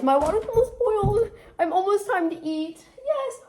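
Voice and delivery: high-pitched singsong glee; excited squeal. Real, not acted. Cook seeing water almost boil, high gleeful squeal.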